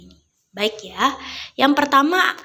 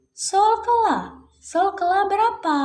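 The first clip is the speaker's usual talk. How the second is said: high-pitched prompting question; singsong quizzing; 10 semitones higher than usual. Pretend. Teacher poses the third question to children, singsong quizzing. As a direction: lilt it high, inviting the class to answer.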